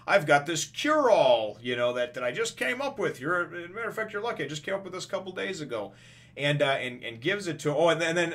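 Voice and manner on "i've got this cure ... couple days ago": high, excited salesman pitch; eager quack doctor. Acted. Quack doctor offering his new cure, high eager voice. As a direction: pitch it up like a proud inventor.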